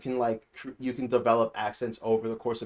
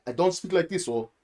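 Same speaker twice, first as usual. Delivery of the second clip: Nigerian accent; 3 semitones higher than usual. Shown as a demonstration. Nigerian reviewer showing how they don't usually speak, Nigerian accent. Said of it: "I can talk like that".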